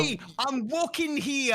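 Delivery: high, slow hammed-up New Yorker; mock New York street yell, pitched high; New York accent. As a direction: stretch it out like a cartoon New Yorker. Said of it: "it's like a New Yorker".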